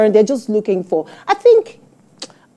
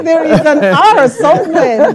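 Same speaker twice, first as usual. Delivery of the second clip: fake American accent; 8 semitones higher, 5 dB louder than usual. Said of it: "rolling R where there is no R".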